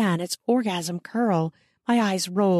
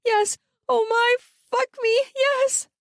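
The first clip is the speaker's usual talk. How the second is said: crying out. Acted. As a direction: cry it out as if overwhelmed. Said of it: "I cried out".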